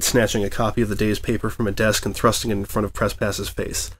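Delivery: ordinary delivery, nothing marked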